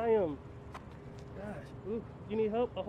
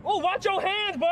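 loud protest; 8 semitones higher, 7 dB louder than usual. Real, not acted. Protesting the officer's search.

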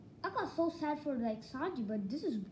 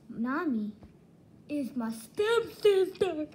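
shocked reveal; 3 semitones higher, 7 dB louder, slower than usual. Real, not acted.

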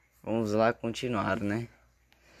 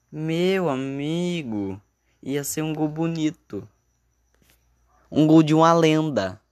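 dramatic commentator lament; 6 semitones higher, 4 dB louder than usual. Acted. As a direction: lament like a TV narrator. (lamento dramático de narrador)

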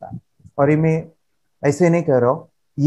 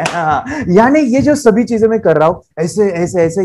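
normal talking voice

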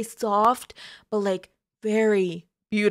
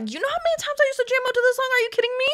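high, fast, thrilled squeal; excited squeal; much faster than usual. Real, not acted. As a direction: gush like a starstruck fan. Fan gushing about favorite song.

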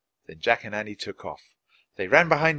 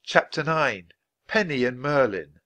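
ordinary delivery, nothing marked

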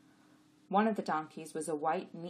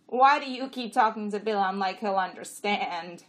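loud, raised donkey voice; questioning donkey; 4 semitones higher, 10 dB louder than usual. A narrator's reading. Jack questioning the jenny, loud and raised. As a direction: ask loudly, pitched up. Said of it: "Costel asked".

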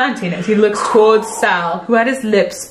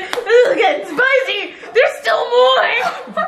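high, excited teasing; 12 semitones higher than usual. Real, not acted. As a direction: say it as a high tease. Repeated, pitched up teasing.

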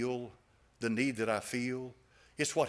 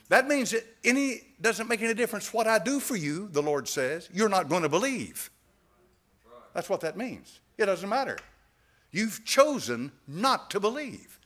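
raised, higher-pitched preaching with hard-hit words; forceful preaching; 6 semitones higher, 5 dB louder than usual. Acted. Preacher tells congregation what the Lord's words mean, forceful preaching. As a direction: drive each phrase home to the congregation.

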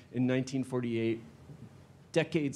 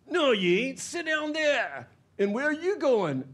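loud, much higher barked orders; bossy thug; 13 semitones higher, 5 dB louder than usual. Acted. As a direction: order them to sit down. Nick bossing the members.

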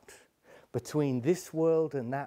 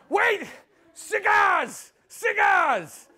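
very high, loud, desperate cries; panicked calling out; frantic shout; 8 dB louder, much slower than usual. Acted. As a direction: yell out a bribe to stop her. Frantic call to stop her leaving.

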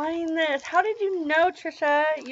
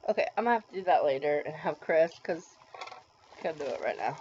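ordinary talk

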